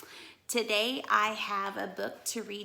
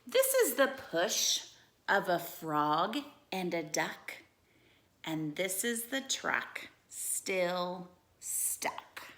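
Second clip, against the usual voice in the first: lively storybook read-aloud; animated bedtime-story reading; reading to children; 5 dB louder than usual. Acted. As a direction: read it like a picture book for kids.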